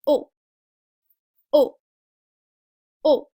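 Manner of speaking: "O" with lips in a tight round circle; rounded French O. Shown as a demonstration. Teacher modelling the French O, lips rounded. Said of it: "tiny little circle into your mouth".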